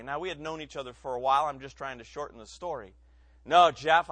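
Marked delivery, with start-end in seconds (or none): 3.4-4.1 blunt refusal. Retold or imitated. Refusing friend's church invite.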